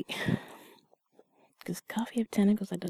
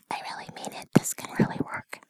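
right in your ear. Acted. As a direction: close intimate whisper. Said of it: "whisper in your ear".

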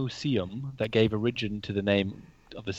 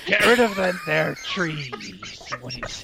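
pirate voice; 5 semitones higher than usual. Pretend. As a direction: play it like an old sea dog. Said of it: "you sound just like an Alaskan pirate".

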